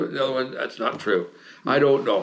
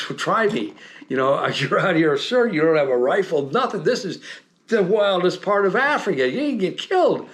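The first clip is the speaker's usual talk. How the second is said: worked-up scolding; 6 semitones higher than usual. Retold or imitated. Telling off the lost lieutenant.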